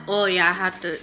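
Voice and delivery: normal talking voice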